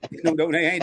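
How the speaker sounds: ordinary talk, nothing marked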